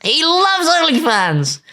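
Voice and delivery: very high, slow, hammed-up squeal; mock exclamation. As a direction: squeal it like a scandal.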